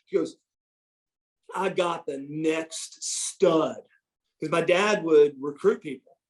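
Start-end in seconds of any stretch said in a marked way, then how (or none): 1.5-3.8 drawn-out, very slow retold boast; slow announcement. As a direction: spread each word out for effect. Dad announcing his new recruit.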